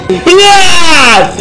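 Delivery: sharp high frightened yell; startled shout. Real, not acted. Sudden scare in game.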